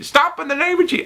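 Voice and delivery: very high squeaky mock command; mock church lady. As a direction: parody a holy rebuke. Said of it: "if she said that, like".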